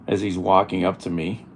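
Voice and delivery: ordinary talk, nothing marked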